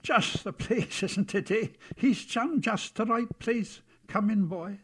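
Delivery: high, slow character voice; chuckling, drawn-out welcome at the door; welcoming Bogle doorkeeper. Acted. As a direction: usher the boy in, high and hearty.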